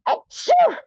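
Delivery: a big high comic sneeze; mock sneeze. A customer sniffs the towel, then a loud mock sneeze.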